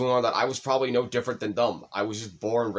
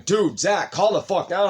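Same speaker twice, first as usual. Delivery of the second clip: higher, louder, urgent calming plea; retold pleading shout; 8 semitones higher, 5 dB louder than usual. Friends begging an angry Zach to calm down, raised voice.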